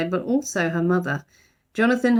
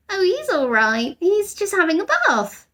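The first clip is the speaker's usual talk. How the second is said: Judy breezy; 11 semitones higher than usual. A narrator's reading. Girl character in story.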